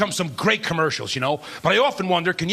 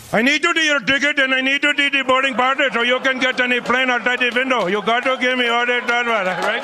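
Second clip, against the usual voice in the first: TSA guy voice; 2 semitones higher than usual. Retold or imitated. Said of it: "and he says".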